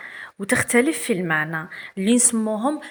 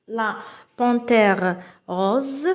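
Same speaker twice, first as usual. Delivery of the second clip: slow reading aloud; much slower than usual. Shown as a demonstration. Teacher reading the text aloud to pupils, very slow. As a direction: read each word very slowly.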